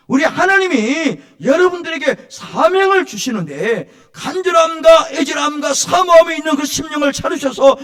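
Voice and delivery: loud, high fervent preaching; preaching at full force. Acted. Pastor preaching loudly to his congregation. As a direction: proclaim it to the congregation.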